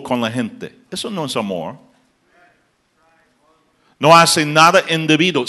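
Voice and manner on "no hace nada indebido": raised, forceful sermon line; a preacher driving the point home; emphatic preaching. Acted. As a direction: state it firmly to the congregation. Preacher stating love's rule.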